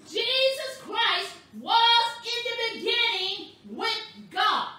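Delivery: high, loud, sustained sermon delivery; half-chanted, exclamatory preacher cadence; full-force preaching. Real, not acted. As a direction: drive it home, stretching the scripture words.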